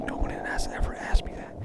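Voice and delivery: hushed whisper to the camera; whispered aside. Real, not acted. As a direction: whisper it like a confession. Aside confessing no one asked.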